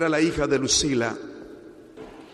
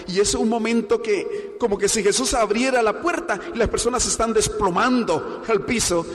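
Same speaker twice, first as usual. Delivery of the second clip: a higher, fervent preaching surge; preaching build; 7 semitones higher than usual. Acted. Pastor describes people collapsing before Jesus, a higher fervent surge. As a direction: push the image out with rising fire.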